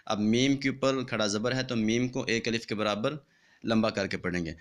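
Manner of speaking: ordinary delivery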